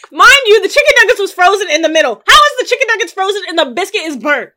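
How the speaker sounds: high, loud, fast outrage; exasperated complaint at full volume; indignant rant. Real, not acted. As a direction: vent about the frozen nuggets. Ranting about frozen nuggets.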